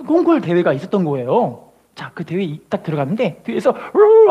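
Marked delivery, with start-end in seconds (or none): 3.9-4.3 much higher, loud rolled trill; mock opera trill. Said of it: "하고 노래를 부르는데".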